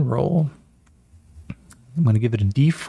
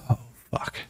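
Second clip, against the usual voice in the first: breathed curse after the danger; relieved whisper; 7 dB quieter than usual. Acted. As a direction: let it out under your breath, relieved. Relief after near snake bite.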